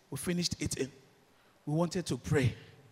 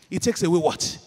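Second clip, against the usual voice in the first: loud rising question to the crowd; call-out question; 7 dB louder than usual. Real, not acted. Preacher calling to congregation, loud questioning. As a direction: throw the question out to the church.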